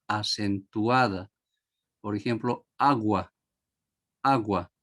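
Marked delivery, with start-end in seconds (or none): 2.8-4.7 agua with the first a stressed. Shown as a demonstration. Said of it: "La primera vocal A es la acentuada".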